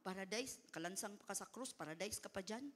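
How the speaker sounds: doubting, quiet mock disbelief; a hint of a skeptic on the cross, quiet and scoffing; skeptical scoff. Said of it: "Siguro kung skeptic ka".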